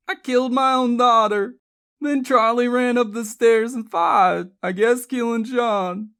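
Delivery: loud, high, distraught; anguished confession. Acted. Mother confesses killing her daughter, anguished.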